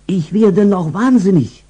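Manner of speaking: high, harried housewife voice; stressed owner before Christmas; exasperated owner. Acted. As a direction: throw up your hands at the chaos. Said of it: "wenn Frauchen immerzu sagt".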